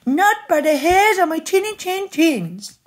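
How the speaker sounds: high, defiant piglet squeal; very high piggy voice; three little pigs yelling. A narrator's reading. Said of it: "yelled the three little pigs".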